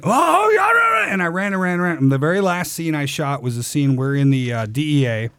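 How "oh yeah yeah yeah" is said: high, shouted eager yeses; excited high yeah yeah. Retold or imitated. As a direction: yelp like an unleashed dog. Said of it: "And I went".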